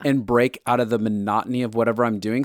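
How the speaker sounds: usual speaking voice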